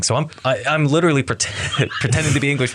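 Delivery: ordinary delivery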